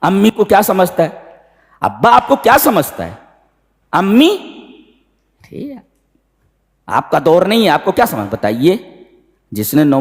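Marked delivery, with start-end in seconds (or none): 0.0-8.3 higher, cheeky taunt of a disrespectful son; mock insolent youth mouthing off at parents; mocking rude youth. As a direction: sneer it like a spoilt youngster. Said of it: "आज भी कहते हैं".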